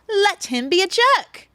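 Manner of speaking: put-on high, loud, drawn-out British accent. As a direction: deliver the punchline in a big British accent. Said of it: "I've got my accent ready".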